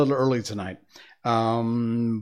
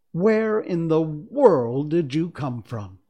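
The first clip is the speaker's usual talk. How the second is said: raised, drawn-out wondering question; amazed cry; 5 semitones higher, 4 dB louder, slower than usual. Acted. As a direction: ask it in loud, slow astonishment. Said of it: "she cried".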